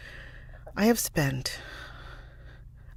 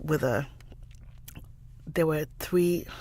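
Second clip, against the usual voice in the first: ordinary delivery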